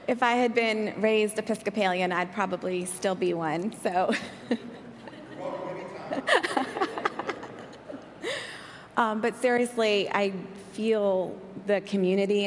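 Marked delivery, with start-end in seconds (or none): 4.2-7.7 breathy laugh. Real, not acted. Laughs at her own joke.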